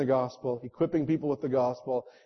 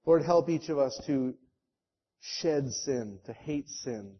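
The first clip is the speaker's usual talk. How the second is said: earnest prayer; 4 semitones lower than usual. Real, not acted. Pastor leads closing prayer.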